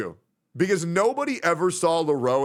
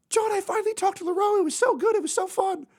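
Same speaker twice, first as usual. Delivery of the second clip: put-on much higher girly voice, gushing; imitating Sophia. Streamer voicing a shy friend gushing about talking to her crush, much higher. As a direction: gush like a shy girl who finally did it. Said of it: "she was like".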